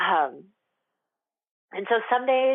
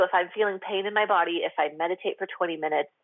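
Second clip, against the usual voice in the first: ordinary talk, nothing marked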